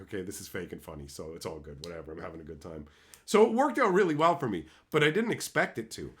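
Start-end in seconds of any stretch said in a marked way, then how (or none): 0.0-2.9 much softer, fast, lower throwaway; offhand shrug. Retold or imitated. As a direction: mumble it like bored acceptance. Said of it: "they were just like".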